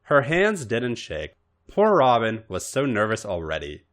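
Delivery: plain reading voice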